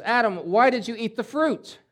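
God questioning Eve. Acted. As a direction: call out the question like a stern judge. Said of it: "and he goes".

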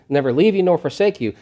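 ordinary talk, nothing marked

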